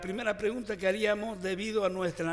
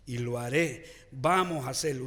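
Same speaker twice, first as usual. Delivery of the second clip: normal talking voice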